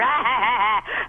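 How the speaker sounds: put-on bully's cackle; mean mocking laugh. Nellie laughs at the stuttering girl she torments, mocking laugh. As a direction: laugh cruelly at the victim.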